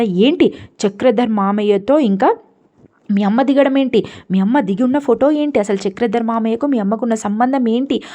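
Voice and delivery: loud, high demand that builds to a yell; furious retold interrogation; angry shouting. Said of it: "గట్టి గట్టిగా అరిచేసరికి".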